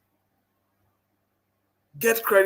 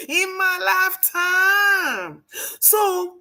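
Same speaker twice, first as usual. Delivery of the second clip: emphatic shout; 9 semitones higher, much slower than usual. Acted. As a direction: shout each word out slowly. Emphatic rally climax.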